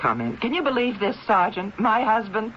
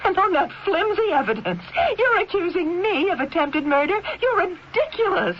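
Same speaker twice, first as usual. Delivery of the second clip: scornful disbelief; 11 semitones higher than usual. Acted. Donna scoffs at the accusation.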